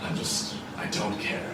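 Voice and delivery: very soft, nearly whispered, low; hushed, listless brush-off; dejected murmur. Acted. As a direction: barely voice it, like a sad drinker wanting solitude. Sad knight brushes him off.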